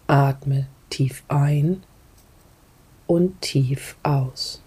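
calm guide, sometimes breathy whisper; guided meditation. Acted. Leads a grounding meditation.

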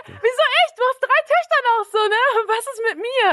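put-on high, outraged teen protest; teen protesting to her uncle, high and indignant; indignant disbelief. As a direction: squeak it up in offended disbelief.

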